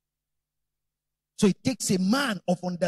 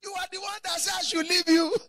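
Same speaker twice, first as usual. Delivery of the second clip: protesting husband; 11 semitones higher than usual. Retold or imitated. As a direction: shout back in disbelief. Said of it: "If I say".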